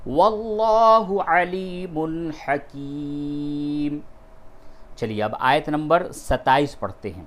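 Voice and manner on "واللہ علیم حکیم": Quran recitation. Shown as a demonstration. Teacher chanting the verse for students, slow and melodic. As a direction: intone the verse slowly and melodically. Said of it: "یہاں پہ وقف کیا تو پورا کیا ہوا".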